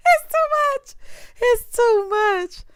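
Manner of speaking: high, slow, laughing through the words; laughing, drawn out. Real, not acted. Recapper laughs at the drama, slow, high and laughing. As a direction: stretch it out while laughing.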